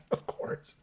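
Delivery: soft laugh melting into the words. Real, not acted. Answering a fan's off-topic question, laughing. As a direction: laugh it off, breathy.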